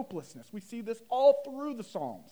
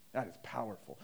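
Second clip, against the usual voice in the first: ordinary talk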